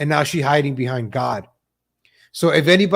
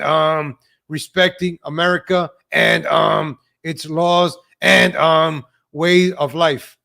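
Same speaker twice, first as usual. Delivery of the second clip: halting monotone full of drawn-out ums; mimicking her speech. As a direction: stall on every phrase with an exaggerated um, imitating her. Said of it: "let me read this in her language".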